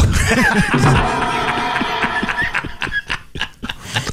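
real burst of laughter. Real, not acted. Host cracks up at his own joke, laughing hard. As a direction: crack up at the punchline.

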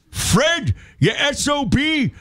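put-on high, slow old man shouting; grandfather yelling. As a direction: yell like a fiery grandpa. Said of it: "my grandfather's like".

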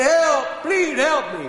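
very high, loud yelps; frantic comic cries for help; hollering for help. Acted. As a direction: holler for rescue while running past. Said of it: "He hollered".

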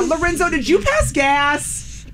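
high, drawn-out teasing squeal; mock shock. Host blaming boy for fart sound, high mock shock. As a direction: accuse him in playful horror, slowly.

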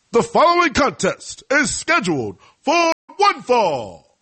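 high, loud, drawn-out ring announcer; Tony Chimmel. Shown as a demonstration. Host showing off for future ring announcer job, bad announcer impersonation. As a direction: belt out a wrestling introduction, badly impersonated. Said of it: "that was a bad Tony Chimmel impersonation".

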